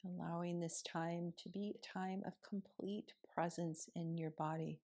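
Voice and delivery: soft, unhurried meditation voice with long pauses; guided meditation. Acted. Yoga teacher guiding students through morning seated meditation, softly.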